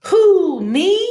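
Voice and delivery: high, loud startled who; surprised owl. Acted. Owl startled by the cactus offering a hug, high loud surprise. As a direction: jump up in pitch, caught off guard.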